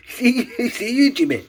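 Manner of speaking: high, loud mock-Scottish squeal; Scottish lingo. Vlogger teases waking child, high loud mock Scottish. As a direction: belt the catchphrase playfully in Scottish. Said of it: "I learned some Scottish lingo".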